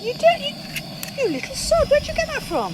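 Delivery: high, loud mock outrage; playful accusing squeal; indignant surprise. Real, not acted. Catches partner's sneaky wine top-up.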